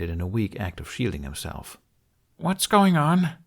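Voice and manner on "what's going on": put-on high, slow, anxious boy's voice; a nervous young man asking from behind a tree; Hiccup. A narrator's reading. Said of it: "he asked".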